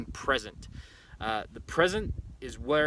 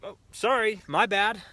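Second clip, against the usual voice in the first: quick high apologetic brush-off, louder; sheepish apology; 8 semitones higher, 6 dB louder than usual. Retold or imitated. Driver apologizing through his open window, airy and quick. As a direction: toss off a breezy sorry like the other driver. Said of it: "and he's like".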